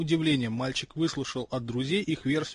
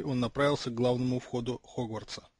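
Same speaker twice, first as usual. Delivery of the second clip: ordinary delivery, nothing marked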